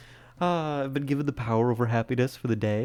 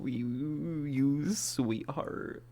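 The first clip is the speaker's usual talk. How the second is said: touched; much slower than usual. Real, not acted. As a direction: melt at the compliment. Touched by compliment.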